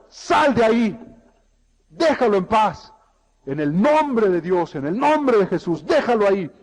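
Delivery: loud, forceful command to an evil spirit; stern rebuke. Acted. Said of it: "y reprendió al espíritu". (reprensión firme y a viva voz)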